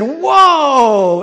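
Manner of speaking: loud, high, long drawn-out exclamation; retold amazement. Man looking at the card he was given, imitated loud amazement. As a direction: imitate an awestruck reaction.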